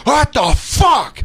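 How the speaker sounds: slow, high bellow of outrage; furious shout. Retold or imitated. Customer blowing up at driver, furious drawn-out shout. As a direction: stretch it out in fury.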